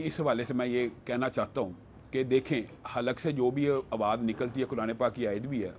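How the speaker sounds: ordinary delivery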